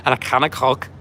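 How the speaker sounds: playful attempt at a Scouse accent; Liverpudlian accent. Shown as a demonstration. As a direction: put on your best Liverpudlian. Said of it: "my best Liverpudlian accent".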